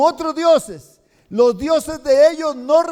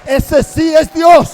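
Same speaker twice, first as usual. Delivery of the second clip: much louder, higher, slow cry; exultant shout; 4 semitones higher, 10 dB louder, slower than usual. Acted. The people on the ground crying out that the God of Elijah is God, loud and exultant. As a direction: burst out in triumphant praise. People proclaim after the fire.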